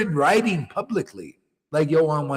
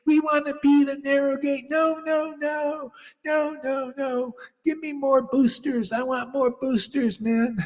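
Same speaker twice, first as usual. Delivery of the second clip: higher, whiny mock voice of stubborn people; mocking the blasphemers; 10 semitones higher than usual. Guru mimicking students who refuse the narrow gate, higher mock whine. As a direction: sneer at them by putting on their silly voice. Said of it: "They won't do".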